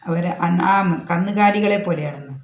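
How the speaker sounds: usual speaking voice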